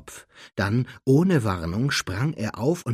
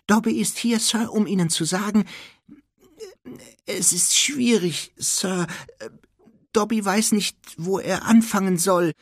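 high squeaky elf, halting; Dobby; 12 semitones higher, 6 dB louder than usual. A narrator's reading. Elf struggles to explain his visit, squeaking haltingly.

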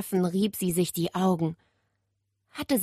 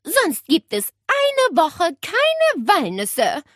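put-on slow, higher, louder stern old-lady order; stern old aunt; 11 semitones higher, 5 dB louder, slower than usual. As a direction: lay down the law, slowly. Aunt's threat continues.

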